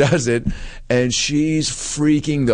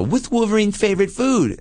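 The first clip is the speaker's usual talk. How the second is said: put-on high nerdy kid voice; squeaky curious kid; 11 semitones higher than usual. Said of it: "nerdy kids would be like".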